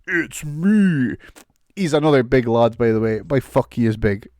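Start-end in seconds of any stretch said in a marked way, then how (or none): 0.0-1.1 imitating Braun. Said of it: "I love Braun Strowman's voice".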